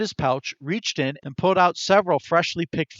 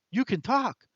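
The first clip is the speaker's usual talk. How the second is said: high, hushed gasp of disbelief; startled realization, voice jumping up; shocked; 9 semitones higher than usual. A narrator's reading. Chester stunned the squirrel speaks, high shocked gasp. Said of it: "Chester leapt up in shock".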